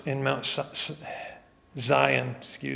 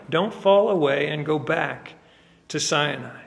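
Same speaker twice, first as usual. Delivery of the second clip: ordinary delivery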